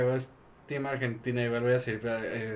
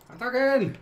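higher, loud battle order; shouted command; 11 semitones higher, 7 dB louder than usual. Real, not acted. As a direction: bark the attack order. Commanding his army to attack.

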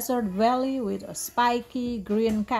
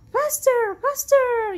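high, loud urging; thrilled, high-pitched cheering on; excited yell; 12 semitones higher, 6 dB louder than usual. A narrator's reading. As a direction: yell to speed the horse on. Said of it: "yelled Lucy".

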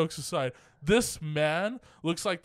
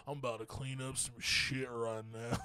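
put-on low, soft lightly drawled cowboy accent; a lazy cowboy accent, quiet and low; 5 semitones lower, 10 dB quieter than usual. Retelling the janitor's line in a cowboy accent. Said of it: "I don't know why he has a cowboy accent".

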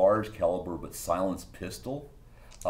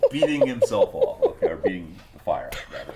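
ordinary talk, nothing marked

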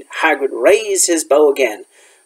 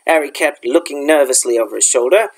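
ordinary delivery

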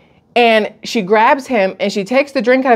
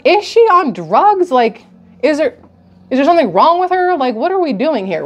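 higher, stunned and incredulous; incredulous disbelief; 6 semitones higher than usual. Retold or imitated. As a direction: react like you can't believe it. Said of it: "And I'm like".

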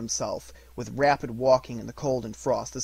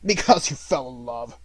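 loud, contemptuous growl; miser's disgusted echo; growled scorn; 7 dB louder than usual. A narrator's reading. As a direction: growl it back mockingly. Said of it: "growled Scrooge".

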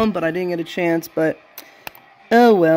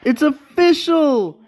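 excited announcement; 8 semitones higher than usual. Real, not acted.

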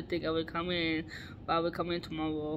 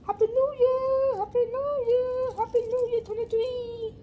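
much higher squeaky new year cheer; squeaky party voice; 4 dB louder than usual. Acted. Squeaky celebration voice with hat.

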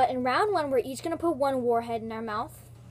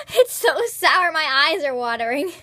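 laughing through sourness; 8 dB louder than usual. Real, not acted.

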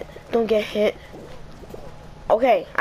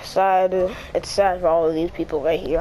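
usual speaking voice

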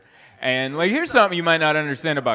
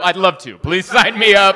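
louder, keen, jumping at the offer; overeager yes; 3 semitones higher, 9 dB louder than usual. Pretend. Comic jumping at a park gig, loud eager yes. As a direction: grab the gig before it's gone.

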